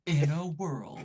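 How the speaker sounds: epic movie trailer narrator. Retold or imitated. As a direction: say it like the famous voiceover guy. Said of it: "He has never said, "In a world".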